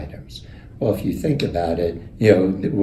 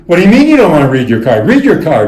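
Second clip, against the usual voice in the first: loud, fast, higher group yelling; mock screaming; 8 semitones higher, 11 dB louder, faster than usual. Company presidents screaming at John to read his card, loud and fast. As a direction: yell it like a pack of angry bosses. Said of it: "they start screaming".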